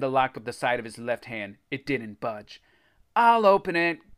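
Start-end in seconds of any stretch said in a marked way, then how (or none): 3.1-4.2 high, loud boast; bragging. A narrator's reading. Greg showing off at the chest, bragging. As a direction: show off, louder and higher. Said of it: "Greg bragged".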